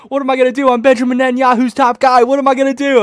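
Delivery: hammed-up despair; mock panic. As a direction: whine helplessly like an injured fighter. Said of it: "He's like".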